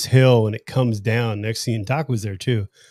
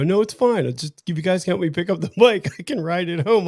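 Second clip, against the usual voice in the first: lightly voiced crash victim, pitched higher; a hint of higher-pitched, insistent pleading; imitated insistent rider; 6 semitones higher than usual. Crashed rider wanting his bike picked up, lightly imitated insistence.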